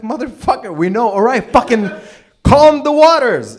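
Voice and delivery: furious yell. Retold or imitated. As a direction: rant at Jesus until it becomes a full-blown shout. Disciples yelling at Jesus mid-storm.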